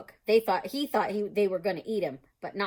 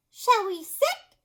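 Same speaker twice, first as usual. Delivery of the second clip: sheep voice; 7 dB louder, slower than usual. Acted.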